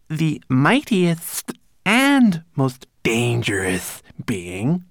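high, slow, drawn-out recitation; obediently recited. A narrator's reading. Servant repeating the corrected line, reciting high and slow. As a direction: recite it back carefully, like a dutiful servant. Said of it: "Twigleg obediently recited".

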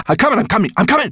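high, hurried repeated cry; urgent cry. A narrator's reading. Sorrel wriggling down the dragon's neck, urgent cry. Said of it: "she cried".

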